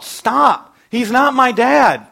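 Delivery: put-on high, loud, drawn-out scream of a frightened girl; child's yell. Girl grabbed by a stranger yelling for help, loud and high. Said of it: "she would yell out".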